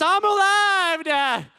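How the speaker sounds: high, drawn-out shout; loud, slow, hammed-up cry; triumphant kid yell. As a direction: shout it like a child suddenly revived. Child instantly revived, cries out.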